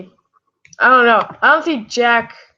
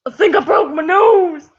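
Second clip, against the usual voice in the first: loud, high, hammed-up wail; slow, over-acted yelp of someone hurt; mock cry of pain; 7 semitones higher, 5 dB louder than usual. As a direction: whine loudly as if just bonked. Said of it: "And it's like".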